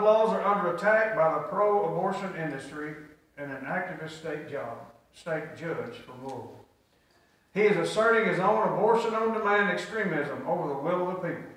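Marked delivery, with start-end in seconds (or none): none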